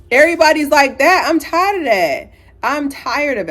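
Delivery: high, loud, fed-up complaint; exasperated rant. Real, not acted. Clubgoer ranting about everybody on phones, exasperated. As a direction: belt it out, thoroughly fed up.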